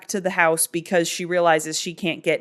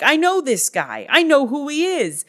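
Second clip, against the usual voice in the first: excited recognition; 8 semitones higher, 4 dB louder than usual. Retold or imitated. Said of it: "Sunday sees that and is like".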